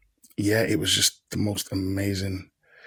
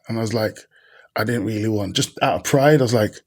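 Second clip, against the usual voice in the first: ordinary talk, nothing marked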